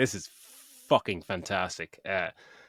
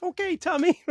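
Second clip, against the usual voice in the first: high squeaky mock reply; meek reply. Jim Carrey answering Tommy Lee Jones, high and meek. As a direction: squeak it out like a cowed man. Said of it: "And you're like".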